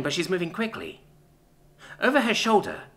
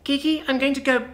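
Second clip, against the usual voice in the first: put-on high childlike voice; little sister, resolute; 10 semitones higher than usual. Child leaving to find help for her sister, high earnest voice.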